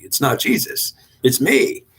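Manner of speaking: lightly voiced devil pointing to himself; the devil insisting he did the saving. Devil claims the credit.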